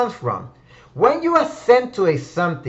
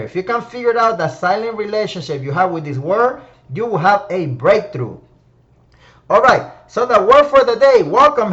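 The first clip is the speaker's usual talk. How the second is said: ordinary delivery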